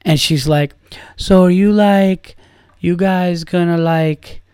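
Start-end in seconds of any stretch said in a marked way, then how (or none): 1.2-4.4 mock European accent, lightly raised in pitch; imitating foreign woman. Comic retelling a tipsy woman's come-on, imitated foreign accent. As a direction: put on a hint of a drawn-out foreign accent. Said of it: "that's the voice that I have".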